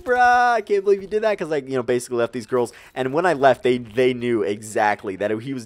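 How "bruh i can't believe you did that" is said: mock disbelief. Telling his friend he can't believe it, high mock disbelief. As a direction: exclaim it high in exaggerated disbelief.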